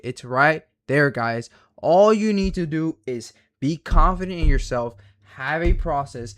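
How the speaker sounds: loud, frustrated ranting; heated rant. Real, not acted. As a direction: hammer it home, exasperated. Ranting at viewers about strategy.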